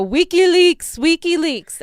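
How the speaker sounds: high, drawn-out mock enthusiasm; hammed-up high, loud praise; mock cheering. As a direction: chant it like a fake fan. Imitating the candidate's enthusiasm.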